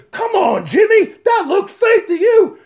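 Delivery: high, mocking disbelief; skeptical spectator. Spectator Alan calling out to Jimmy, scoffing. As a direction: scoff at the fakery. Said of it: "Alan Luke says".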